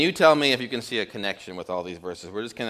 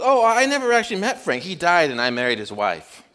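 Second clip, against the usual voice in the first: cabbie punchline; 6 semitones higher, 3 dB louder than usual. Retold or imitated.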